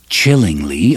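ordinary delivery, nothing marked

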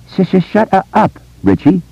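very high-pitched stammering protest; stutter. A narrator's reading. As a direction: stammer through an exasperated command. Stuttering boy silences his friend.

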